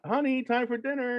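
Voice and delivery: high sweet sitcom-mom call to dinner; mock mom calling. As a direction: sing it out sweetly like a mother calling her kid. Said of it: "you hear the mom's like".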